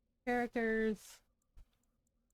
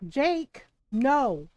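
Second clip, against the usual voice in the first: loud angry no; angry shout; 4 semitones higher, 8 dB louder than usual. Real, not acted. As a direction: shout him down, real anger. Scolding the dog.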